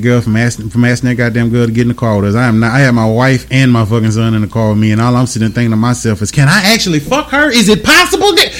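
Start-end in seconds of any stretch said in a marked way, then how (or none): 6.3-8.5 high, wondering self-questioning; tempted inner thought. Retold or imitated. Man asking himself about a temptation, high and wondering. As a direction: voice the thought higher, climbing as it goes.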